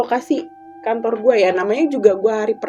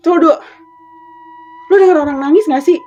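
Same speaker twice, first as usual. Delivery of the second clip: alarmed question; 6 semitones higher, 8 dB louder, faster than usual. Retold or imitated. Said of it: "Gue bilang gitu kan".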